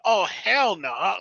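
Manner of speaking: mock outrage, stretched out slowly; imitated outraged girlfriend.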